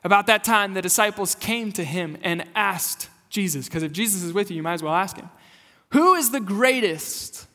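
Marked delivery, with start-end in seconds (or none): 5.9-7.5 high, slow, emphatic question; posing the question. Retold or imitated. Disciples ask Jesus who ranks highest, posed emphatically. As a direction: stretch GREATEST out, pitched up.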